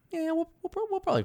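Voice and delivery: very high, hesitant mimicry; mock naive assumption. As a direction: squeak out a stumbling, wishful guess.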